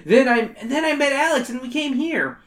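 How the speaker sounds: high, whiny schoolkid; whiny kid voice. Acted. Wally character voice.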